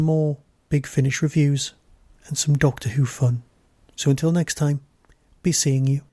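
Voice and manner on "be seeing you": usual speaking voice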